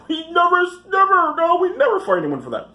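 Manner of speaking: sneering send-up of the camp's denial; mock camp official. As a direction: parody the denial in a whiny high voice. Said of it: "mocking the camp's denial".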